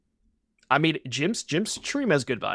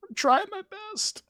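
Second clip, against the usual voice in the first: much higher, tiny cartoon squeak; squeaky little voice. Streamer pleading with chat in a squeaky high voice. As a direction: pipe it up like a small pleading creature.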